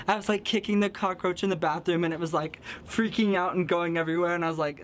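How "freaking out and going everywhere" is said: ordinary delivery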